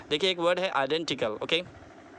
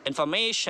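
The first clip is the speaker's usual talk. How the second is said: information with a long "ma"; stress on MA; much slower than usual. Shown as a demonstration. Teacher models the next word, long stressed "ma" in information. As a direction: draw out "ma" in information. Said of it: "मैं पर stress है".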